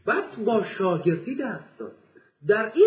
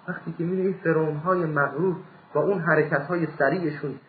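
usual speaking voice

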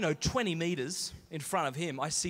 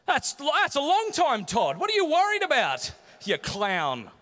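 high, loud shouted brush-off; overdone mock reassurance; 9 semitones higher, 8 dB louder than usual. Comedian retelling how he dismissed Todd's shark fears, high mock shout. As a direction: yell it with hammed-up, panicky bravado.

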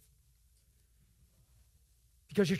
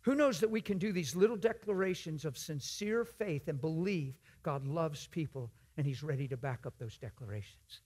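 usual speaking voice

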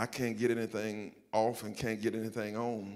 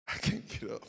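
helpless whisper; 8 semitones higher than usual. Retold or imitated. Stuck at bottom of the stairs.

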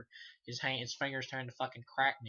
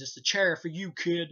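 higher, louder sneering Freddy voice; loud mocking Freddy impression; Freddy taunt; 5 semitones higher, 10 dB louder than usual. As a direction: belt it out like a gleeful movie villain.

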